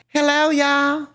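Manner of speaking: high, bright neighbour-lady voice, pitched well up; friendly old lady imitation; Linda's greeting. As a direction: greet them like a sweet Southern neighbour lady. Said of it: "And she's like".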